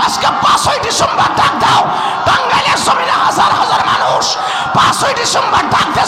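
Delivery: very high, loud shouted preaching; a fiery sermon climax, screamed out; preaching at full force. Real, not acted. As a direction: whip the crowd into a roar.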